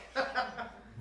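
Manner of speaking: high, loud, scornful burst of laughter; bitter laugh. Acted. Ellie angry on the phone.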